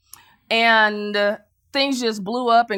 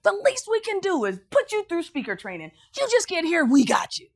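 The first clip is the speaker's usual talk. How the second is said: higher, emotional woman's voice; excited woman; 10 semitones higher than usual. Retold or imitated. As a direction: gush gratefully like her. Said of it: "the woman said".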